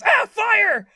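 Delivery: high, loud startled scream; freaked-out SpongeBob. Retold or imitated. SpongeBob startled in bed at night, panicked high shout. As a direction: jolt awake with a yell of alarm. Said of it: "SpongeBob freaks out".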